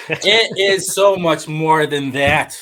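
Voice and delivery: loud, slow laughing insistence; laughing protest. Real, not acted.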